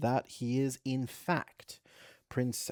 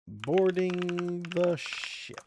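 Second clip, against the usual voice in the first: usual speaking voice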